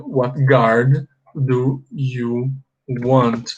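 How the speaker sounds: very slow, clearly spaced what guard do you want; slow model. Shown as a demonstration. Teacher slowing the barber's question down for students. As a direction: spell it out slowly for learners.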